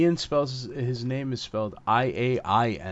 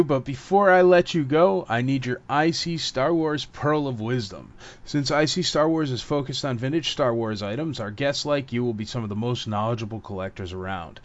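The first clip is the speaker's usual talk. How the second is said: scripted segment read. Real, not acted. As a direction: read out the show's set question, punching its opening. Host reads scripted segment question.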